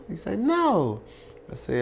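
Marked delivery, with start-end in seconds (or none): 0.4-1.0 high-pitched drawn-out no; high retold reply; retold answer. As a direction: answer high, quoting someone else.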